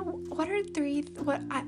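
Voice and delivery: usual speaking voice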